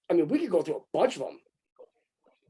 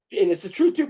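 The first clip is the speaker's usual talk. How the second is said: normal talking voice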